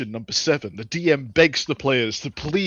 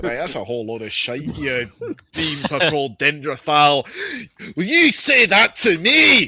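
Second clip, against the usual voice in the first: angry dwarf; 4 semitones higher, 3 dB louder than usual. Acted. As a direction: roar the threats, dragging each word. Dwarf insults elf.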